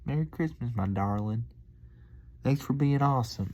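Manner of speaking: high, sweet Southern country lilt; hamming up the country waitress; country accent. Retold or imitated. Vlogger replaying the waitress's farewell in a high sweet Southern country accent. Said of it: "just countryed it up".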